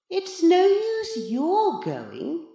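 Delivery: high, slow, condescending voice; smug cat in boy's body. A narrator's reading. Giant reflection tells Maurice not to go, smugly. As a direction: put him in his place, slowly and loftily.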